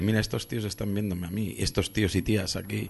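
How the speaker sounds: hushed awe. Retold or imitated. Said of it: "les ves ahí y dices".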